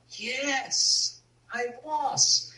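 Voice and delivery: put-on small, high child's voice answering; inner child voice. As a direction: answer like a scared little boy. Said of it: "I talked to my inner child".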